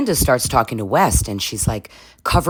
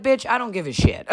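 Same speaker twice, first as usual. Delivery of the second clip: laughing mock; 9 semitones higher, 4 dB quieter than usual. West unbothered, mocked by vlogger.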